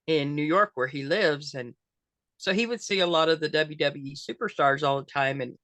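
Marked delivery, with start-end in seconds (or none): none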